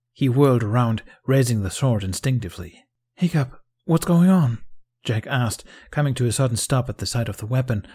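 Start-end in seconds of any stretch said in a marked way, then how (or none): 3.2-4.6 slow, loud, high alarm; raised, anxious questioning; distressed, higher pitch. A narrator's reading. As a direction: call out in startled distress. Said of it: "Jack's voice was slightly breathless and higher in pitch".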